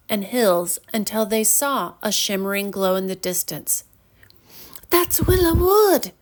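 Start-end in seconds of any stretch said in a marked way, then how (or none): 4.9-6.1 higher, glad call on seeing home; excited cry. A narrator's reading. Marlo spotting home, excited cry. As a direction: call out with joy. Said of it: "cried Marlo".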